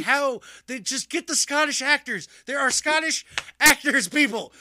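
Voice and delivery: loud, high incredulous outburst; loud disbelieving rant at the casting; exasperated. Retold or imitated.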